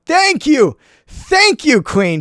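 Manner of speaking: high, delighted thank-you to his hero; gleeful gratitude to a game character; excited thanks. Real, not acted. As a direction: cheer the queen on, pitch jumping up. Hero queen pulls off attack.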